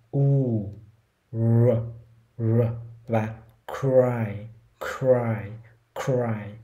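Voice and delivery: "u, r, r, cry": a slow rounded u, held r, then cry repeated; three-step r drill. Shown as a demonstration. Teacher drills cry in three steps. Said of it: "các bạn cũng làm ba bước cho mình".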